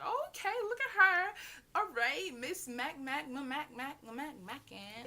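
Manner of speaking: high, sing-song teasing of a friend. Retold or imitated. Teasing best friend about her new boy, high and playful. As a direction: tease her like a nosy bestie.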